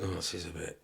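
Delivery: muttered thought. Retold or imitated. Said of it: "you just think".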